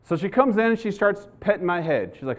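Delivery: ordinary talk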